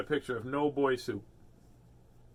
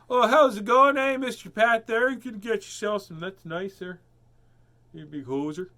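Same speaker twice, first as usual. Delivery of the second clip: loud, high mock Canadian accent; playful hammed-up Canadian accent; 9 semitones higher, 8 dB louder than usual. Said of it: "You got that Canadian accent going good".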